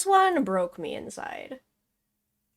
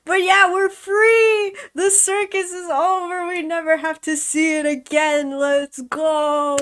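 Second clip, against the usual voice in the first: joyful shout; 6 semitones higher, slower than usual. Real, not acted. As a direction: celebrate at the top of your voice. Streamer celebrates finishing the case.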